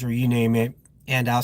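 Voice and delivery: ordinary talk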